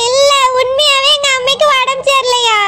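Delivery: hammed-up tearful plea, last words stretched; fake crying. Wife insisting her mother is really ill, fake crying. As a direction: wail insistently, tears put on. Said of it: "உன் அழுகைய பார்த்தா".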